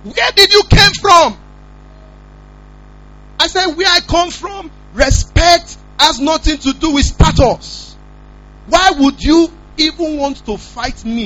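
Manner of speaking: high, loud outraged telling-off, slowing down at the end; indignant scolding. Retold or imitated. Lecturer rebuking students who wanted to fight him, high loud indignant scolding.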